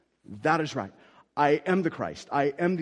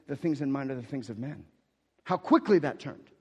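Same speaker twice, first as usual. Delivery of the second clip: ordinary talk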